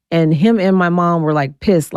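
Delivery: normal talking voice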